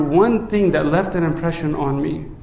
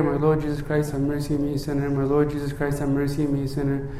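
low, soft run-on recitation; rote repetition; 5 semitones lower, 7 dB quieter, faster than usual. Retold or imitated. Imitating people repeating the prayer, low rote murmur. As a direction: murmur it over and over in an undertone. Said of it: "whenever people are repeating this prayer".

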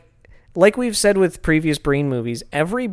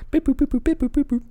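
high beeping tune; 9 semitones higher than usual. Pretend. Imitating a game tune.

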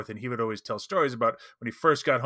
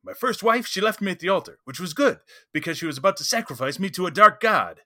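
raised, louder punchline delivery; comic card read; 7 semitones higher, 6 dB louder than usual. Acted. Reading a joke card at the end, loud comic delivery. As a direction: read it out like a stand-up gag. Reads comedy card aloud.

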